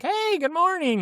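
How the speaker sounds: bright, high cheerful greeting; happy and chipper. Retold or imitated. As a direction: sing out a cheery good morning. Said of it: "like, all happy and chipper".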